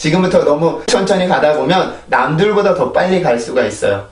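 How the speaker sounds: usual speaking voice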